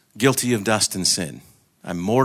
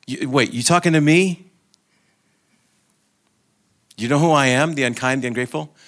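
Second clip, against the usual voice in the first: higher, louder flustered protest; incredulous soul; 4 semitones higher, 4 dB louder than usual. Acted. The unkind, ungrateful soul to God, incredulous. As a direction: stammer in disbelief, questioning upward. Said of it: "the soul says".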